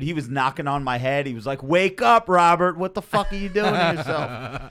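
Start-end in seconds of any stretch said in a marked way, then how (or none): 1.6-4.7 loud, much higher doctor scolding; mock scolding yell. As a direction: shout at him to wake up. Doctor scolding him loudly.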